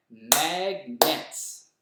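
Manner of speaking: MAG-NETS clapped out in two loud beats; clapped syllables. Shown as a demonstration. Teacher claps out the syllables of "Magnets". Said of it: "let's clap it and see how many syllables".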